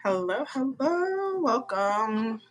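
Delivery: ordinary talk, nothing marked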